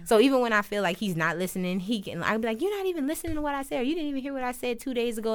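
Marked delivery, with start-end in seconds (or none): 2.6-5.3 rattled off fast and high; quick exasperated quote to partner; fast retold complaint. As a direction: rush it out like an old grievance.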